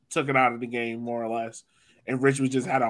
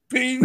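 high, louder sharp ping sound; imitated ping; 10 semitones higher, 6 dB louder than usual. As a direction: make the ball's ping noise, bright and quick. Imitating the ball's pass sound.

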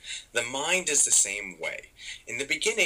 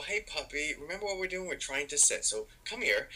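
higher, softer coaxing; gentle with a puppy; 6 semitones higher, 3 dB quieter than usual. Retold or imitated. Owner coaxing a puppy back to sit, gentle and soft. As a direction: coax a wandering puppy back, softly. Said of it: "Instead, we go".

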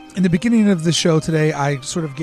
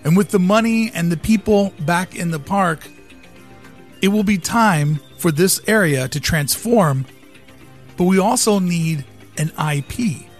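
dramatic speech; 5 dB louder than usual. Acted. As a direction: deliver it like a keynote pitch. Dramatic visionary monologue over music.

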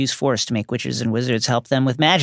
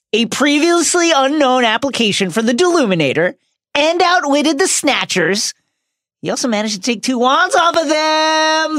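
mock-grand proclamation; 11 semitones higher, 4 dB louder than usual. Listing Ron's feats for the listeners, mock-grand proclamation. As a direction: proclaim it high and stretch the endings.

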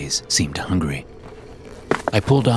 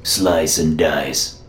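gravelly voice; 2 semitones lower, much slower than usual. Acted. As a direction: drag the words out in an old rasp. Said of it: "he said in a gravelly voice".

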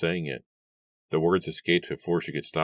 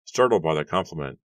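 ordinary delivery

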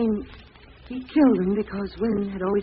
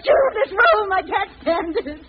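much higher and louder shrieking pleas; hysterical terror; 12 semitones higher, 8 dB louder than usual. Acted. Lona shrieking at the killer to leave the room. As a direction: break down in panic.

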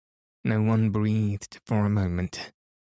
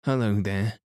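ordinary delivery

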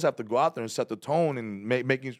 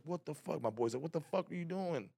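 exasperated friend; 8 semitones higher, 9 dB quieter than usual. Retold or imitated. Friend angry at him backstage.

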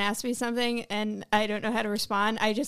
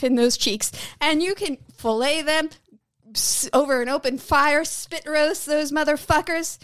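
sneering wrestling villain. Pretend. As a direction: rant like a wrestling heel cutting a promo. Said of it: "do you wanna cut a promo?".

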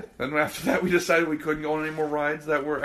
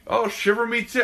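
pitched-up pirate exclamation; pirate character, raised voice; live pirate; 6 semitones higher than usual. Retold or imitated. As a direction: play a pirate calling out. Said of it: "there's a live pirate".

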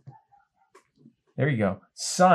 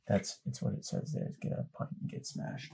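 whisper; 16 dB quieter than usual. Real, not acted. Aside repeating the Scottish note.

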